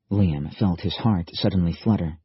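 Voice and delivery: ordinary delivery, nothing marked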